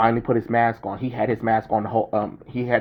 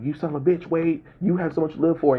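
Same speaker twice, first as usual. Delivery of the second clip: higher, pleading protest; upset Wolverine; 7 semitones higher, faster than usual. Retold or imitated. Wolverine protesting Wade's sacrifice, higher upset plea. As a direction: protest like a friend who can't stop him. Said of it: "Wolverine's like".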